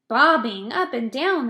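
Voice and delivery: loud, startled outcry; alarmed cry. A narrator's reading. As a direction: shout it back in disbelief. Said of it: "they cried".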